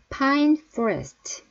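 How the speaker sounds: pine forest very slow, popped P and lip-teeth F; careful P and F. Shown as a demonstration. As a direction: enunciate each sound of pine forest. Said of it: "잘 발음을 해주세요".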